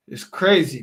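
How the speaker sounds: ordinary talk, nothing marked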